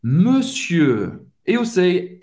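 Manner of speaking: normal talking voice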